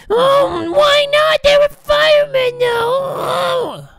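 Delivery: hammed-up squealing outburst; shrill protest. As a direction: yell the excuse like a whiny employee. Employee protests to the boss.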